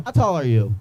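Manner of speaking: ordinary delivery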